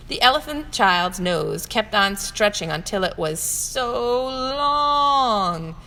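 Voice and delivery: long held and lifted; stretched long. A narrator's reading. Stretched word for long nose.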